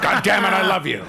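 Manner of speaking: mocking an overwrought soap actor shouting; mock angry love. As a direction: yell the love line like a soap hothead. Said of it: "they were like".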